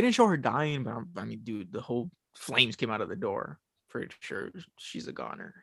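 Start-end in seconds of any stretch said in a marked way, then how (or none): 3.9-5.5 whispered aside. Real, not acted. Aside about a character dying.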